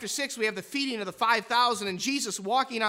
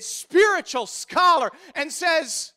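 normal talking voice

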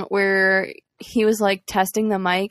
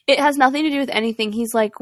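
usual speaking voice

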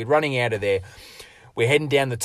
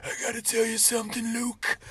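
high, soft put-on voice; dying man's confession; 12 semitones higher, 7 dB quieter than usual. Dying Outback confides to his partner, soft and high. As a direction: whisper it like a dying secret. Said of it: "he looks at me and goes".

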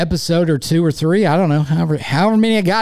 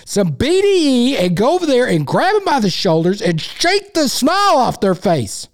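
exasperated rant; 7 semitones higher than usual. Real, not acted. Rant about the team.

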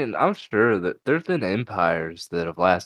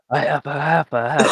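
mock drunken stammer, higher pitched; 6 semitones higher, 5 dB louder than usual. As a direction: imitate a drunk friend babbling the same words. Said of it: "or something out of your drunk".

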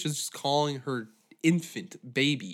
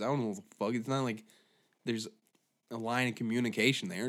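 ordinary talk

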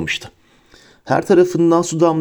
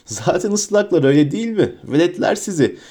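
mischievous taunt; 5 semitones higher than usual. A narrator's reading. Peeves taunts the soaked students.

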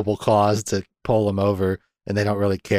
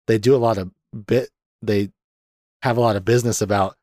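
ordinary talk, nothing marked

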